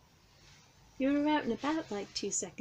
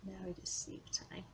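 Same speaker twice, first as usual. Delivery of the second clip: coaxing whisper; 11 dB quieter than usual. Real, not acted. Whispering to sleepy cat.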